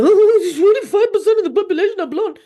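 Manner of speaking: hammed-up high, loud alarm; mock shock. Host mocking people shocked by blonde statistics, mock shock. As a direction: gasp it out in exaggerated, fast, shrieky panic. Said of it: "we don't turn around and go".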